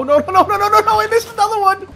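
high loud panicked nos; panicked shout. Real, not acted. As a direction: yell no in rising panic. Missing a funnel.

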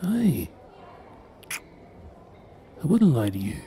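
ordinary talk, nothing marked

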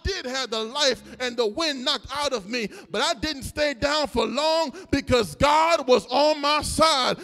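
loud preaching over the music, rising to a cry; full-force preaching. Acted. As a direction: preach it out, the crowd with you. Preacher building to a climax.

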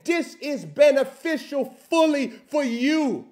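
loud, high, impassioned sermon peak; preaching at full force. Acted. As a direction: build the preaching to a shouting climax.

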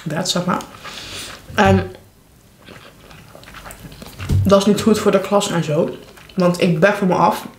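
ordinary talk, nothing marked

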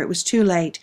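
plain reading voice

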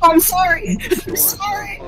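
whiny high fake sobbing apology; mock crying. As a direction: whimper out a sorry. Apologizing after hitting teammate.

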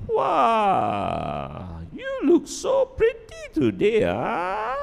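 a squealing, high, drawn-out fake compliment; fake gushing. Shown as a demonstration. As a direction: gush it falsely, far too sweet. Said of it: "Genuine and fake".

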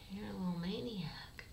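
soft unvoiced teasing; hushed affectionate whisper; whispered tease. Real, not acted. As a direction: whisper it fondly.

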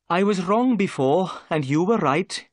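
mildly sad inspector owning up. Acted. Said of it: "said Jones sadly".

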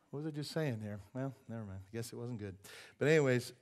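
ordinary talk

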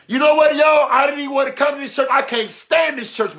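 high, exasperated blurting; candid testimony. Shown as a demonstration. Churchgoer admits not wanting to come, high and blunt. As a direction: own up bluntly to the congregation. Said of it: "show you what a real testimony is".

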